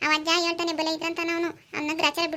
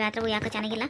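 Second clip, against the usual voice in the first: ordinary talk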